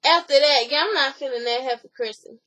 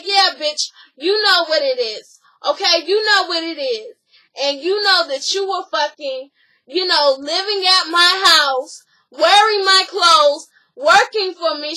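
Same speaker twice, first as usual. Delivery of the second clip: mock angry accusation; 4 semitones higher, 7 dB louder than usual. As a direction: throw the accusations in her face. Acted quarrel, accusing the other woman.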